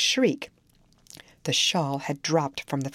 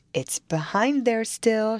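anxious; 6 semitones higher, 3 dB louder, slower than usual. A narrator's reading. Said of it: "she pursued anxiously".

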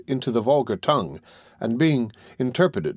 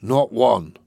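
husky with regret; 4 dB louder than usual. A narrator's reading. Pupil mourning his arrested friend, husky. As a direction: repeat it heavily, full of regret. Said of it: "in a voice rendered husky by regret".

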